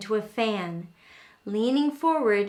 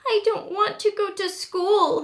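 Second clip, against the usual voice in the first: put-on high, pleading child's voice, louder; crying little raccoon; 9 semitones higher, 5 dB louder than usual. A narrator's reading. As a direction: whine it like a small kid who won't go.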